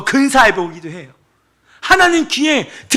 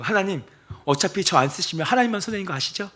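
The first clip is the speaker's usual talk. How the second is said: cheeky prayer; 3 semitones lower, 8 dB quieter than usual. Retold or imitated. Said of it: "제가 요즘에 하나님 앞에 기도하는 거".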